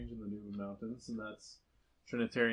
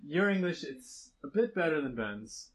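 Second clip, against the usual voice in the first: imitating the translators; 6 semitones higher, 4 dB louder than usual. Translators voiced praising English.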